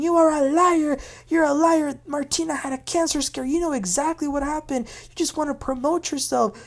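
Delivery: high-pitched imitated accusation; mock screaming. Recapper acts Julia screaming at Gertie, mock screaming. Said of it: "Julia is screaming".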